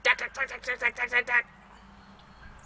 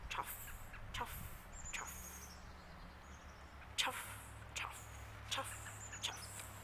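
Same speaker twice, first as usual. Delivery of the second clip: soft chuffs fading to a breath; quiet shoe-scuff chuff sounds dying away; soft footstep chuffs; 3 semitones higher, 19 dB quieter, slower than usual. A narrator's reading. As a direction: let each chuff fade softer until it's only air. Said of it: "They make a soft sound".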